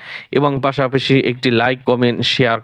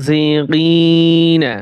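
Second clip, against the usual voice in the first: slow drawn-out reading; 2 semitones higher, 3 dB louder, much slower than usual. Shown as a demonstration. Teacher to students, showing how to blend the syllables, slow chanted reading. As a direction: stretch every syllable, very slow and sung out. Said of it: "বানান করে পড়বেন".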